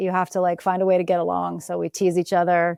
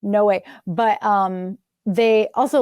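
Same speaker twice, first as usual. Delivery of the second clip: normal talking voice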